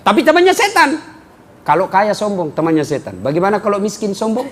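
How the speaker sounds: ordinary talk, nothing marked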